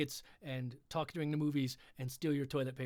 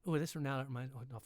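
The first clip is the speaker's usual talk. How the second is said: usual speaking voice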